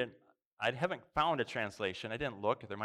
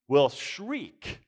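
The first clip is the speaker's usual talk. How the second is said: loud, raised stress on shriek; emphatic shriek; 3 semitones higher, 9 dB louder than usual. Acted. Preacher intensifying what the stones will do, loud emphasis. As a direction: punch out the shriek with force.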